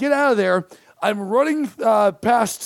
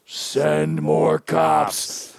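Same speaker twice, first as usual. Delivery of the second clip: much lower, very slow drawn-out zombie groan; a zombie on a police radio calls for more cops; much slower than usual. Retold or imitated. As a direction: drag the words out like a movie zombie. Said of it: "gets on the radio and goes".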